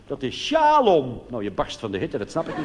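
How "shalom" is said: greeting quote. Retold or imitated. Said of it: "het eerste wat ze dan tegen je zeggen".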